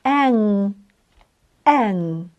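"Ен" said as a low nasal Polish vowel; correct nasal e. Shown as a demonstration. Teacher modelling the correct nasal sound, soft. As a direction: hum the nasal "Ен" softly twice. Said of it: "вимовимо правильно".

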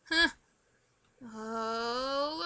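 usual speaking voice

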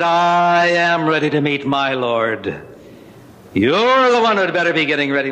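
slow, booming giant's reply, lightly voiced; a hint of a big foghorn, slow and steady. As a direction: giant answers the little man, slow and booming. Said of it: "with a voice that matched it like a foghorn".

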